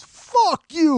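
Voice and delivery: loud, high imitated curse; furious yell. Scalper cursing with his hand up, furious yell. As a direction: blast the insult out in a mock rage.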